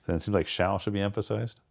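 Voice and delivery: ordinary delivery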